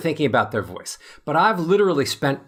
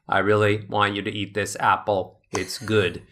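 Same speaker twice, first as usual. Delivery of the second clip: flat unemotional; 7 semitones lower than usual. Shown as a demonstration. Offering someone an apple with no emotion, flat and low. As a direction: say it with no feeling at all. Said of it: "So if I say".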